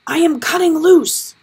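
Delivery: high, loud desperate yell. A narrator's reading. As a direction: shout it as you cut the rope. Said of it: "Howard yelled".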